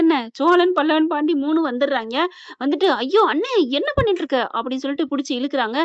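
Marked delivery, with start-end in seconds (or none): 3.0-4.4 higher-pitched dismayed outcry; acted alarmed protest. Relatives pulling brother away from the hug, acted alarm. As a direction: cry out in mock shock, pitched up.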